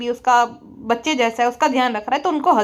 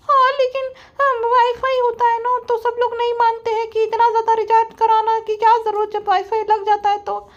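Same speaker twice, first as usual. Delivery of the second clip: mock vlogger voice; 9 semitones higher than usual. Roaster mocks the vlogger boasting about success, high smug voice. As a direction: puff up and brag in a squeaky put-on voice.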